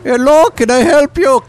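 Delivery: high, loud Indian call-centre greeting; caricatured Indian accent, shrill and eager; mock Indian accent. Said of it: "you hear India".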